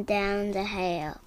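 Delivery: plain reading voice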